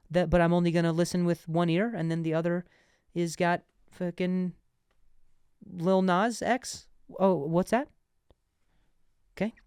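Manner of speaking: put-on high, loud dismissive teen voice; snotty teenager brushing off an old man; mocking teen kid. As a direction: sneer it like a bored kid with one earbud in.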